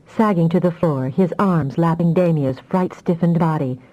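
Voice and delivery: plain reading voice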